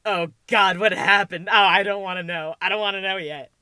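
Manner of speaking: loud, hammed-up dread; mock panic. Fan reacting to a worrying update, mock panic. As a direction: blurt it out in mock panic, easing off.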